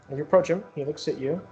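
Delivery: ordinary talk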